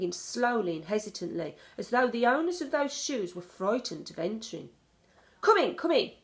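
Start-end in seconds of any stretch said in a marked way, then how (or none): 5.4-6.1 high, loud impatient snap; snapped. A narrator's reading. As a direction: hurry them in curtly. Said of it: "snapped the Grand High Witch".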